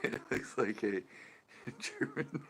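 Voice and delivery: talking through real laughter; laughing. Real, not acted. Streamer cracking up over what it looks like, laughing. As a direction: let the laugh break into the words.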